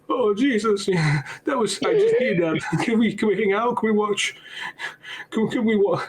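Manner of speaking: high-pitched, over-eager pleading; hammed-up clingy devotee; needy hanger-on. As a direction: beg for company in a put-on high voice. Acted needy man asking Jesus to hang out.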